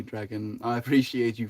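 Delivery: ordinary talk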